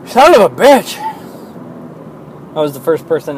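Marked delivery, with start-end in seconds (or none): 0.0-1.0 sudden loud high curse; angry outburst. Real, not acted. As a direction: yell it out in frustration.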